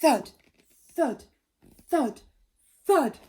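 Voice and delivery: loud, heavy footstep thuds; booming thuds. A narrator's reading. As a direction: stamp out each thud like a giant. Monster footsteps sound effect.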